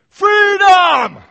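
William Wallace battle cry. Retold or imitated. Preacher imitating William Wallace rallying his troops with a shout. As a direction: roar it like a rebel leader before battle. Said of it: "William Wallace".

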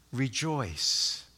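almost under the breath, a settled answer; quiet answer. Shown as a demonstration. Preacher answering God commands us to what, soft and low.

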